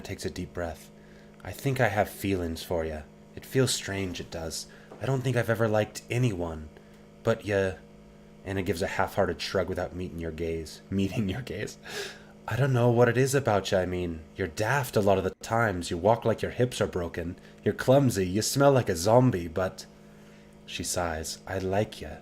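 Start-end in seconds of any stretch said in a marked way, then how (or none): 1.4-7.7 put-on shy Scots girl admitting feelings; halting Scottish confession; Anna, Scottish lilt. 12.5-22.1 put-on teasing Scots girl, louder on the insults; rough Scottish teasing; Anna, Scottish lilt.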